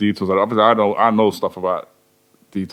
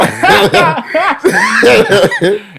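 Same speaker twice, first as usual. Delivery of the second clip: big, high burst of laughter; amused high laugh; loud laugh; 6 dB louder than usual. Real, not acted. Laughing at the dodge.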